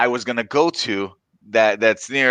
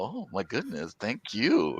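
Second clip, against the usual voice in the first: very soft, private thanks; hushed retold inner voice; thinking to oneself; 11 dB quieter, slower than usual. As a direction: imitate a grateful thought, barely voiced. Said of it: "I'm just thinking to myself".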